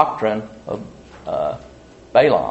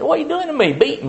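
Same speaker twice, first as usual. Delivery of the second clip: high squeaky donkey voice, much higher pitch; talking donkey; faster than usual. Retold or imitated. Beaten donkey complains to Balaam, high squeaky voice. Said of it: "the donkey opened his mouth ... and said".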